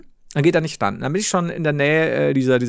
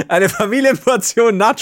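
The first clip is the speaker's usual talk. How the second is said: high laugh into the words; 6 semitones higher, 5 dB louder than usual. Real, not acted. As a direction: laugh out "Familienportion Nachos". Laughs telling the nachos. (lachend, hoch)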